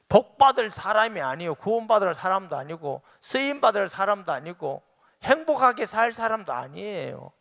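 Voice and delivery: high, loud fervent preaching; preaching fervently. Real, not acted. Pastor preaching loudly and high to congregation. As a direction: drive it home to the congregation.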